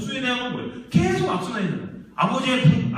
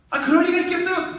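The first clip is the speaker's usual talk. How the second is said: higher, louder pitch in mock protest; exasperated protest; 7 semitones higher, 5 dB louder than usual. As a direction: act out a frustrated denial of the accusation. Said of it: "얼마나 답답하겠어요". (답답해서 높고 크게 항변하는 흉내)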